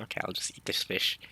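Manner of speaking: whispered, half to himself; muttered to self. Retold or imitated. Imagining eating leftover fish in the morning, whispered. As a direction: mumble it like a sleepy thought. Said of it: "And I'm like".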